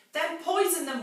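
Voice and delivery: high, loud command; sharp loud order. Acted. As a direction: bark the order down the stairs. Mrs Bean snapping an order.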